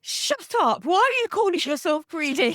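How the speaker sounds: combative inner critic. Retold or imitated. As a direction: snap at yourself like a bully in your head. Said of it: "this combative way of talking".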